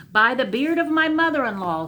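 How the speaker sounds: high, loud royal bellow; a sultan thundering his fury. Acted. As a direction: roar it at the young man in outrage. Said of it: "thundered the Sultan".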